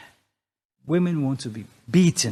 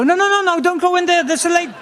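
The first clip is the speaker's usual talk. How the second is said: high, loud falsetto warning; mock high lady voice; 13 semitones higher, 6 dB louder, faster than usual. Comic telling men in the restroom there's a lady, high falsetto. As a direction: squeal it like a panicked woman. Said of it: "And I had to go".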